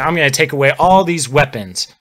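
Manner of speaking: ordinary talk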